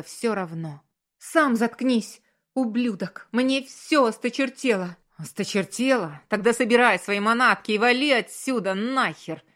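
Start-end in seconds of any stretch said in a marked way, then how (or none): 1.2-5.0 loud, high, angry comeback; furious retort. A narrator's reading. Wife no longer caring about his rage, furious retort. Wife shouts back in quarrel. 5.2-9.4 loud, aggressive snarl. A narrator's reading. Husband throwing his wife out, snarling. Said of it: "оскалился он".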